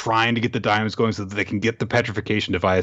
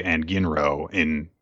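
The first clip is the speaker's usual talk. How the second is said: ordinary delivery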